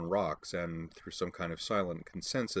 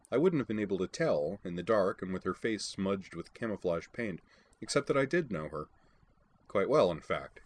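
ordinary delivery

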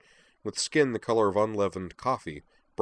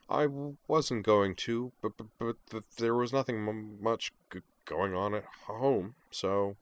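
mild stutter; 2 semitones higher than usual. A narrator's reading. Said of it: "Kate's stutter became milder".

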